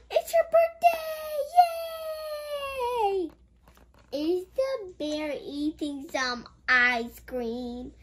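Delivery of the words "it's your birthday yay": gleeful high cheer; excited cheer. Real, not acted. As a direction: cheer for the birthday. Cheers at birthday page.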